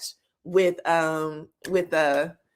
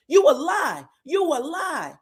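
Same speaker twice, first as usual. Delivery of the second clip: high-pitched mock yell, easing on the repeat; retold scream; 7 semitones higher than usual. Recapper acting Ken accusing Trisha, screaming. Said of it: "He starts screaming that to her".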